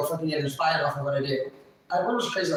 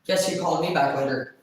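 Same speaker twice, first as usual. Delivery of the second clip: ordinary talk, nothing marked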